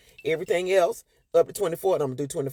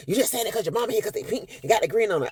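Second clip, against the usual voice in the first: raised, accusing dad voice; hammed-up husband blaming the mother; mock angry dad; 6 semitones higher than usual. Husband snapping at wife over pink shoes, mock angry.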